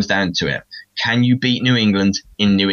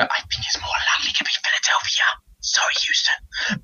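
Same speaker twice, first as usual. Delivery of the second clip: whispered aside; 4 dB quieter than usual. Real, not acted. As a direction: whisper it like a guilty confession. Whispered aside to Houston fans.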